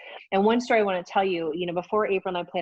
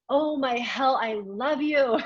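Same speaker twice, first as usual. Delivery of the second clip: higher and louder, gushing; thrilled; 7 semitones higher, 3 dB louder, much slower than usual. Retold or imitated. Thrilled reply to her partner's idea, higher and louder. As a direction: burst out in delighted gratitude. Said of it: "And I was like".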